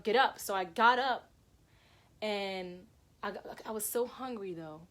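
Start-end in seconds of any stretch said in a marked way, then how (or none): 0.0-0.4 loud, emphatic final command; raised-voice push to oneself; forceful self-urging. Retold or imitated. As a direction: burst out with the order.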